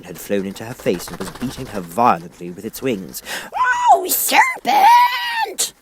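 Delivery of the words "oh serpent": very high, loud shriek of a bird; high loud pigeon scream. A narrator's reading. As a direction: shriek it at the intruder. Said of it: "screamed the pigeon".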